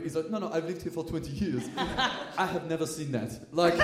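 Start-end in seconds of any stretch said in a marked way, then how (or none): 0.3-1.7 put-on soft French-accented waiter. Waiter correcting a nosy diner, French accent. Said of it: "he had a French accent". 2.4-3.3 put-on soft French-accented waiter; quiet astonishment in a French accent. Waiter baffled by the double pasta order, French accent. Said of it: "he had a French accent".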